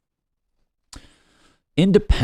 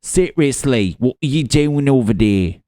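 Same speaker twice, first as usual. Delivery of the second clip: put-on loud, higher, garbled British accent; botched British accent; 5 semitones higher, 8 dB louder than usual. Britain demanding answers from America, botched British accent. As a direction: do a badly faked British accent, indignant. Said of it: "what accent was that?".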